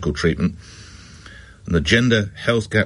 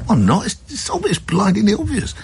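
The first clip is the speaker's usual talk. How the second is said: smug husband retort; 12 semitones higher than usual. Retold or imitated. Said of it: "husband retorts to wife".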